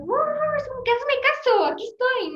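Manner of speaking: high, startled voice; caught off guard. Retold or imitated. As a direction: act surprised and flustered. Speaker acts self in class.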